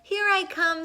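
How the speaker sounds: high, loud happy answer; joyful reply. Acted. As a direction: call back eagerly as you swim over. Rainbow fish answers friends' call.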